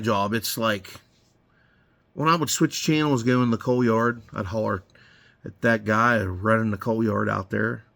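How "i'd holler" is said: ordinary talk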